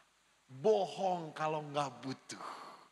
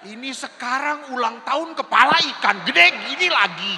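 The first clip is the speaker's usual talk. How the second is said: laughing loudly; 7 semitones higher, 14 dB louder than usual. Real, not acted. Laughing about the big fish head.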